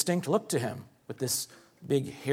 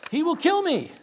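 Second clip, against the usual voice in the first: high, loud cry on 'kill'; much higher and louder frightened cry; exclamation with emphasis; 5 dB louder than usual. Acted. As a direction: throw it out as a desperate exclamation. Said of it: "That's an exclamation point. I had to say it with emphasis".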